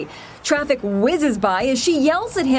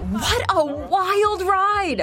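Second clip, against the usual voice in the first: excited; 3 semitones higher, much slower than usual. Acted. Dramatic story opener.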